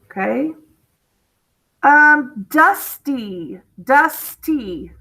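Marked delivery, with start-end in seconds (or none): 2.5-4.9 "dusty" as DUS, short gap, TEE; split into syllables. Shown as a demonstration. Teacher showing two syllables, "dusty" split as dus...tee. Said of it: "Two syllables".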